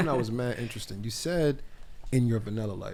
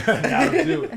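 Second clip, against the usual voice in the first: laughing mimicry; 5 dB louder, much slower than usual. Mimicking the seductive command, laughing.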